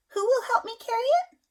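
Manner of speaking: high, loud pleading hen voice; hen asking for help. A narrator's reading. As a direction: ask brightly like the little hen. Hen asks animals, read-aloud.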